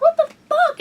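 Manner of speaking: high, startled what the fuck; sudden high shocked cry; shocked outburst. Retold or imitated. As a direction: yelp it in disbelief.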